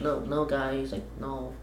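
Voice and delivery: very soft, meek no; mock timid pushover; timid refusal. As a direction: barely say it, shrinking away. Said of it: "He's just like".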